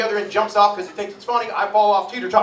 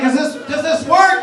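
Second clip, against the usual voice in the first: put-on higher and louder, a boy's doubtful question; kid asking, higher; 7 semitones higher, 8 dB louder than usual. Boy asking his dad if the cream works, higher and louder. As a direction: ask it like a confused nine-year-old. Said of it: "I'm like".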